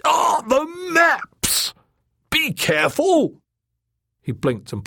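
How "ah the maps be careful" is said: very high, drawn-out alarmed squawk. Acted. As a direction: squawk it loud and slow, fussing over your things. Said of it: "Sunderland squawked".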